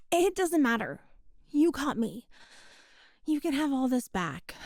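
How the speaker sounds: ordinary talk, nothing marked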